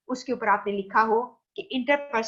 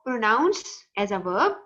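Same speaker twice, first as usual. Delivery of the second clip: slow, deliberate teaching; much slower than usual. Shown as a demonstration. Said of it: "teacher stresses the verb form".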